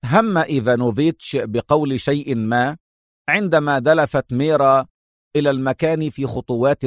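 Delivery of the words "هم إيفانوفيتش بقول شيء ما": ordinary delivery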